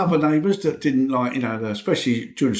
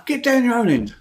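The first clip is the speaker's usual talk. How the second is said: put-on higher, raised voice telling kids off; grumpy neighbour yell; 9 semitones higher than usual. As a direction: shout the kids off down the street.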